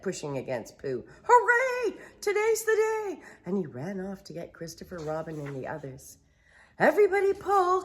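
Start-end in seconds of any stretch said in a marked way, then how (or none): 1.2-3.2 joyful cheer. Acted. Rabbit cheers. 6.8-7.8 high, loud, fast yell; rally everyone to pull. Acted. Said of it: "Christopher Robin yelled".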